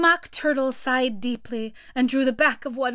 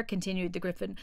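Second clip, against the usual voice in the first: usual speaking voice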